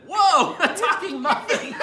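loud high gasp of a shocked muffin, laughing through it; startled muffin. Acted. Dad voicing the second muffin, loud, high and laughing. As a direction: play the muffin amazed that the other one talks. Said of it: "The second muffin looked at him and said".

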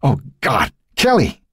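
high-pitched, horrified cry of a father; father's distraught high cry; anguished cry. A narrator's reading. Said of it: "Jerry Conroe cried out from above".

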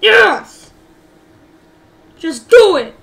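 loud, high bursts of delight; excited shouting. Real, not acted. Gamer getting the road racing program, excited shout. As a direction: cheer it out loudly.

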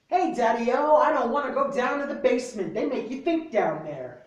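loud, punchy slam poetry delivery; spoken word poem. Acted. Reading his poem to friends in a bookstore, loud slam declamation. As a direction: declaim it like a slam poet calling out the crowd.

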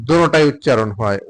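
ordinary talk

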